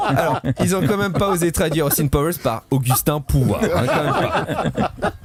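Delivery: talking through laughter, pitch high; words bubbling with laughter; laughing. Real, not acted. Laughing at film translations. (en riant, voix haute)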